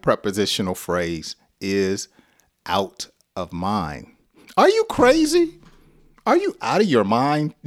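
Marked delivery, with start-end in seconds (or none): 4.6-7.5 high-pitched, playful disbelief; mock incredulous. Teacher acting out questioning someone's sanity, mock incredulous.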